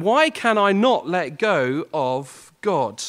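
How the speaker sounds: usual speaking voice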